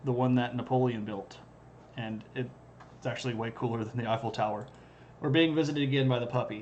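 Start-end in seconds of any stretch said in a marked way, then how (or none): none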